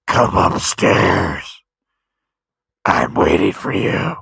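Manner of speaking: low, slow, loud ghostly call; haunting voice. Retold or imitated. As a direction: beckon upstairs like a ghost. Someone off camera go.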